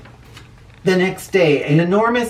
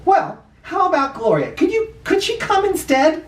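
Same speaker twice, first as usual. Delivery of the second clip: high, hopeful principal voice; pleading principal; 14 semitones higher than usual. Acted. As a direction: coax him gently in a high voice.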